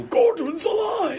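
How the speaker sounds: huge, high, drawn-out roar; triumphant booming shout, stretched out; Brian Blessed. Retold or imitated. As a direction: bellow it like a hammy film hero. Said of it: "so that he would go".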